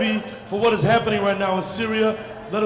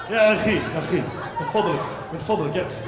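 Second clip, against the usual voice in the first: low, soft pleading coo; mock soothing; 7 semitones lower, 4 dB quieter, much faster than usual. Comic calming a furious brother, soft pleading. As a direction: gently beg him to calm.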